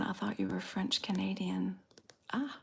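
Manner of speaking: ordinary delivery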